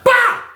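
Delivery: high, sudden impact noise; imitated smack. Storyteller imitating a smack to Skate's head, sudden exclamation. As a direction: voice the hit as a sudden high burst.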